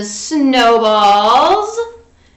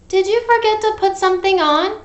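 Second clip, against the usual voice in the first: high calling mom; 9 semitones higher than usual. Acted. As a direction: call to Froggy. Mom calls Froggy.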